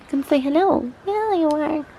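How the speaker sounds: high-pitched cooing to a dog; pet talk. Real, not acted. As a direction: coo it high to your dog.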